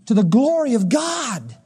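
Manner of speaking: slow, ringing shout of the answer; shouted climax. Acted. Preacher answering his own question to the congregation, shouting slowly. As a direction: call it out to the whole church.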